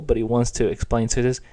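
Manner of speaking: ordinary delivery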